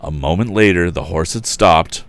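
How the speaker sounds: ordinary delivery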